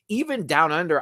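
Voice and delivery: normal talking voice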